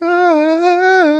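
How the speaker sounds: high, loud held "ah"; majestic sung note. Acted. Player shows Buckley's wind-blown entrance, majestic held note. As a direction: sing out a sustained heavenly tone. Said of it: "majestically and he's like".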